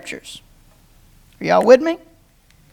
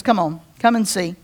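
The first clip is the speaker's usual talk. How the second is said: lower, softer patient invitation; gentle invitation; 8 semitones lower, 5 dB quieter than usual. Retold or imitated. Jesus patiently answering the preacher, softer. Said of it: "he says".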